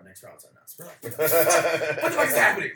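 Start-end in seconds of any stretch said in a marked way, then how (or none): 0.0-0.8 whispered, much softer; secretive bouncer whisper; hushed aside. Retold or imitated. Bouncer quietly offers drinks. 1.0-2.7 can't believe your luck; astonished laughing outburst; laughing disbelief. Real, not acted. Stunned at free drinks.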